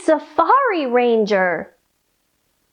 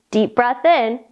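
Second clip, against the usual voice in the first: ordinary delivery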